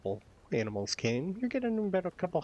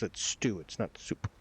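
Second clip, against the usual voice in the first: gruff correction; 6 semitones lower, 3 dB quieter than usual. Acted. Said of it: "smacks, uh, the mayor in the back and goes".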